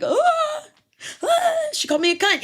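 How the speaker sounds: high-pitched, loud mock gasping whine; exaggerated shocked falsetto, hammed up; mocking outrage. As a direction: mimic scandalized men in a squeal. Mocking men's shocked reaction.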